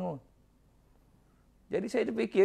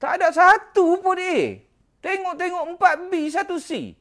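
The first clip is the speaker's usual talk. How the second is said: high, slow and louder, stretched out in dismay; shocked disbelief; 12 semitones higher, 3 dB louder than usual. Retold or imitated. Father reading his son's certificate, high, slow, shocked. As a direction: let the shock of the results ring out. Father sees the exam results.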